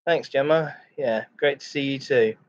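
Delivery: usual speaking voice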